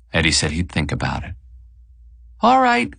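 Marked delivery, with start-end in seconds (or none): 2.4-2.9 high-pitched call across the party; mock yell, pitched far up; wife yelling. A narrator's reading. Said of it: "she yells now from the sheet cake".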